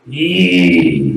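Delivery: low, drawn-out annoyed sound; hammed-up low displeasure; pissed. Shown as a demonstration. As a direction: hold it long and low, irritated. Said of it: "or you're pissed".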